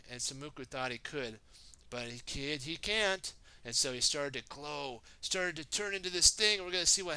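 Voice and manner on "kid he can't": ordinary delivery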